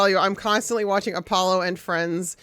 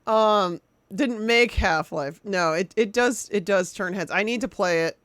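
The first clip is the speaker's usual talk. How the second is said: ordinary talk, nothing marked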